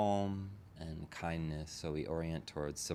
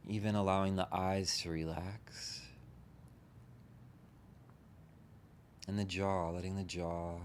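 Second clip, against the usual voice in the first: unhurried meditation guide, long pauses between phrases; guided meditation. Real, not acted. Teacher leading a group into relaxing the body, guided meditation. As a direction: gently talk them into settling, leaving space after each phrase.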